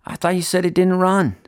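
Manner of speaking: Charlie's voice. Acted. Charlie speaks in story.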